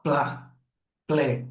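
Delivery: "plá" and then "plé", each said alone; model syllables. Shown as a demonstration. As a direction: set out "plá", "plé" one at a time for the children. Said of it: "Se eu pego uma vogal". (sílabas "plá" e "plé" pronunciadas separadas)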